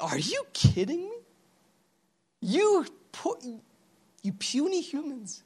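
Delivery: high, hushed incredulous scoff; mocking. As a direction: scoff from on high in a soft, lifted voice. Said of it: "way of mocking them".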